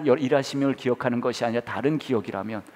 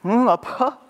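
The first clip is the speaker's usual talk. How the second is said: put-on high child's voice replying to dad; little daughter answering; 12 semitones higher, 5 dB louder, much slower than usual. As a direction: answer innocently as a small girl. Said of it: "그랬더니". (어린 딸이 천진하게 대답하듯)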